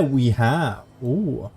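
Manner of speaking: ordinary talk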